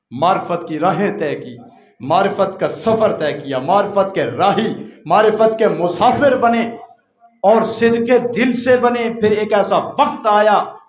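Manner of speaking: rising from steady to loud, high preaching; building preaching. Acted. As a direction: build up to full force. Building exhortation. (آہستہ آہستہ بلند ہوتا وعظ)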